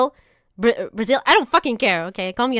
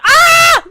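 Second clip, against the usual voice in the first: a sudden, much louder, much higher shriek of fright; startled scream; 13 dB louder than usual. Real, not acted. Shown a spider picture he dreaded, startled high scream.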